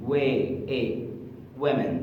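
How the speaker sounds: normal talking voice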